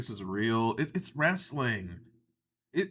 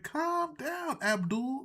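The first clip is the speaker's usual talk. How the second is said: high, drawn-out plea; mock calming down; 10 semitones higher, 4 dB quieter, much slower than usual. Telling the accented wrestler to settle, high and drawn out. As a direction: stretch it out slowly in a high voice. Mock reply to the African character.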